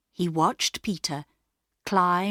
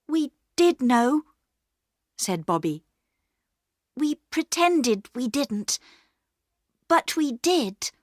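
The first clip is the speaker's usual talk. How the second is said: put-on higher, earnest girl owning up; Bobby voiced; 6 semitones higher than usual. A narrator's reading. Bobby telling the station master they helped, higher and earnest. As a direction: stand by your brother.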